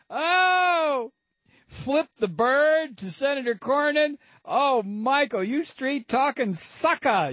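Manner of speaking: high, hammed-up swooning voice; mock swooning. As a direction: gush over him like a smitten fan. Host swoons mockingly over Steele.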